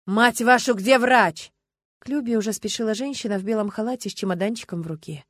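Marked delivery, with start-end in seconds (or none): 0.0-1.5 loud, angry demand; forceful, desperate outburst; frantic yell. A narrator's reading. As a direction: shout for the doctor, cursing. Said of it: "не своим голосом заорал Стас".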